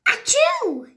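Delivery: high loud achoo; acted sneeze. Gerald sneezing again, loud high sneeze. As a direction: blast out the sneeze.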